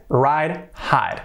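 ordinary talk, nothing marked

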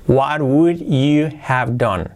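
"What would you have done?" slow and spaced out; slow word-by-word model. Shown as a demonstration. Teacher repeats the question for learners, slowly, word by word. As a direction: stretch each word for learners.

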